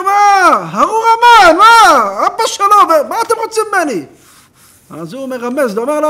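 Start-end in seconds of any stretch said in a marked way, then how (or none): 0.0-4.0 high, loud panicked cries; cornered man crying out in fear; frightened outcry. Retold or imitated. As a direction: yelp like a man being beaten.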